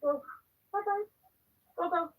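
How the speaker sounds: high-pitched call to a pet; high little commands, with short gaps; shooing the cat. Real, not acted.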